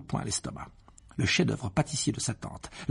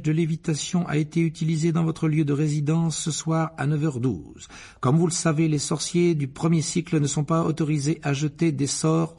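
louder, formal bureaucratic reading; official letter read; 5 semitones higher, 4 dB louder than usual. Acted. Reads the Ministry letter aloud.

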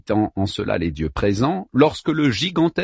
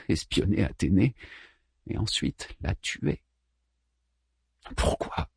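whispered thought; 3 semitones lower, 7 dB quieter than usual. A narrator's reading. Pensée secrète, chuchotée.

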